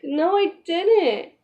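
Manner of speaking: retold protest, high and drawn out; indignant denial. Woman denying she drew the line, indignantly. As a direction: stretch it out in mock protest.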